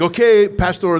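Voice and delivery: loud, high imitation Filipino accent. Inmates coaxing the visiting pastor, imitated Filipino accent. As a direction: call out in a put-on Filipino accent. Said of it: "That's my Filipino accent".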